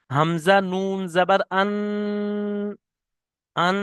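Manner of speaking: slow হামজা নুন জাবার আন, with আন held in the nose; nasal hold demo. Shown as a demonstration. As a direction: hum the ন of আন through the nose, one alif long. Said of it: "যেমন দেখেন".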